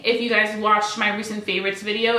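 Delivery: usual speaking voice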